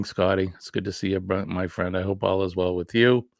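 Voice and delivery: normal talking voice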